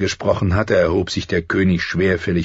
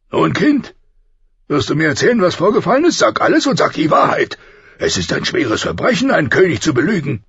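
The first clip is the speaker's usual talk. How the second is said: higher, louder stern king; rumbling with anger; 11 semitones higher, 4 dB louder than usual. Acted. The king demands the truth from Arya, stern and angry. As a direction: press the child for the truth, barely holding back. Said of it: "seine Stimme grollte vor Zorn".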